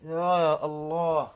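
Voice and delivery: slow, loud cry to God; loud invocation. Real, not acted. Ranting against foes, a slow loud appeal to God. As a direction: call out to God, slowly and loud. Calling on God mid-rant.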